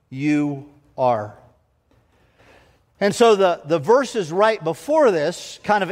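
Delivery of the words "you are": firm, falling "You are"; emphatic. A narrator's reading. Preacher to his flock, stating it emphatically. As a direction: land "You are" as a certainty. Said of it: "He said emphatically".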